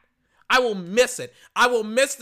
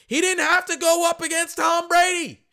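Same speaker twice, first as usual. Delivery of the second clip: loud; 10 semitones higher, 6 dB louder than usual. Real, not acted. Heated rant.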